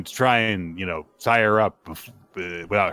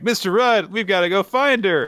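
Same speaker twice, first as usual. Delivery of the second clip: high, louder acted urging; exaggerated rallying appeal; urgent plea; 11 semitones higher, 5 dB louder than usual. Gil urging the fiance to search, high urgent plea. Gil urges a reluctant man.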